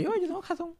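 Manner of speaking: high, self-satisfied I-told-you-so; smug quote. Retold or imitated. To his partner, a gloating high-pitched boast. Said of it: "que yo tenía razón".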